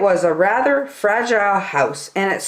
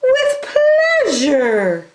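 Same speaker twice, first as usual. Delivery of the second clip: high, sing-song flamingo; bright bird voice; cheerful flamingo; 4 dB louder, slower than usual. A narrator's reading. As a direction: offer it gladly. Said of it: "said the flamingo".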